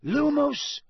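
high, drawn-out incantation; spell incantation. Acted. Dumbledore lighting his wand, high spell call. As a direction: cast the spell, pitched well up.

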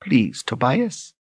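slow, high, soft plea; slow pleading. Acted. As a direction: beg him softly and slowly. Lunetta begging her brother.

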